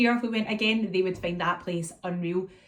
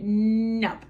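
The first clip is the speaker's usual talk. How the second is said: drawn-out, louder "nope"; imitated toddler defiance; 2 semitones higher, 5 dB louder than usual. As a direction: stubbornly stretch out the refusal. Imitates toddler refusing again.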